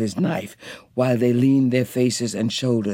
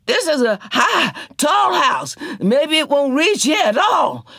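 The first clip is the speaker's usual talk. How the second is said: high, louder hopeful Janey; Janey counseling; 13 semitones higher, 4 dB louder than usual. A narrator's reading. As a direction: reassure them the house is tall. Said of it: "Janey counseled".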